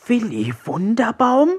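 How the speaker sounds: high, broken little frog voice; broken creature voice. A narrator's reading. The ailing creature asks from its niche, in a broken voice. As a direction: ask feebly in a high creature voice. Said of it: "mit gebrochener Stimme".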